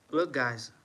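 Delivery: ordinary talk, nothing marked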